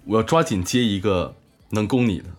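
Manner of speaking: ordinary delivery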